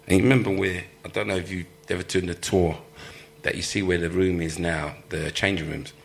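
normal talking voice

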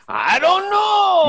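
very high, loud, slow yell. Retold or imitated. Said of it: "And I'm like".

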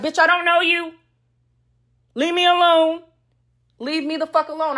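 high and sharp, shooing her away; mock telling off. Telling off the side woman.

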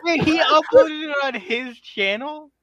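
a stunned "What?" bursting into high, loud laughter; shocked laughter. Real, not acted. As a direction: crack up in disbelief at the news. Shocked at the Renee video.

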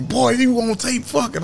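high-pitched, drawn-out mock astonishment; imitated scandalized friend, high and stretched; retold alarmed call. Retelling callers' reaction to the leak.

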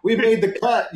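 high, laughing mock cheer from the commenters; laughing quote. Commenters celebrating their town on screen, laughing high. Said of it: "They were like".

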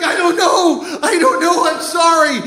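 high, helpless acted wail; frantic high-pitched apology; panicked pleading. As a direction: beg like a kid caught stealing. Kid answers screaming boss.

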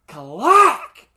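sharp, loud high clack of a snapping rod; high, loud engine clack; loud imitated clack. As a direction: bang it out like metal breaking.

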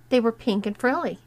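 ordinary delivery, nothing marked

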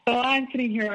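usual speaking voice

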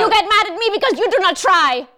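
put-on high, emphatic German-accented scolding; forceful German accent. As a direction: hammer out a reproach in a German accent. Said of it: "very forceful".

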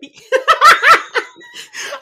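high shriek of laughter. Real, not acted. Cohost cracking up at Whitney, shrieking laugh.

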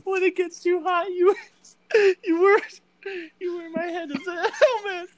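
high, cracking laugh through the words; can barely get it out for laughing; laughing hard. Real, not acted. Friends joking about corpses, breaking up laughing.

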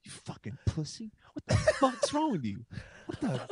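hammed-up rant of an angry inner self; the other [name] yelling. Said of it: "the other [name] started going".

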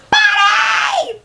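very high, anguished stage scream; dramatic wail. Acted. Actor re-enacting dropping to the knees, high wailing shout. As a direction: cry the name out in over-the-top despair. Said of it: "drop to my knees at one point".

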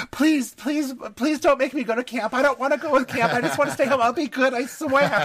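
high-pitched mock pleading; hammed-up, whiny begging in a very high voice. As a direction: plead like a kid desperate to stay home. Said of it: "she's begging Drake".

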